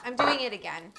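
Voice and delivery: ordinary talk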